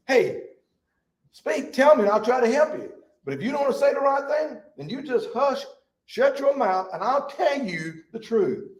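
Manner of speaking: high-pitched, overbearing mock lecture; arrogant. Elihu ordering Job to hush, arrogant high voice. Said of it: "Well, ain't that arrogant?".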